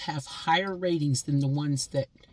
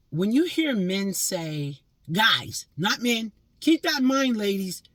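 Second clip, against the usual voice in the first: rising higher and louder to a point; emphatic warning; 5 semitones higher, 4 dB louder, slower than usual. Real, not acted. Woman warns ladies about guys, rising louder. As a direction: build up and hammer it home.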